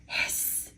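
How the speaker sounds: soft unvoiced cat hiss; a quiet breathy cat hiss. A narrator's reading.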